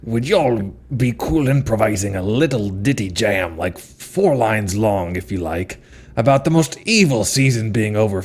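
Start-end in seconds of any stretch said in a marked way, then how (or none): none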